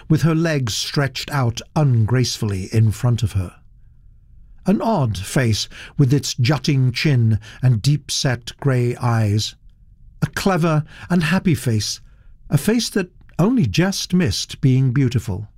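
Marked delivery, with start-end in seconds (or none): none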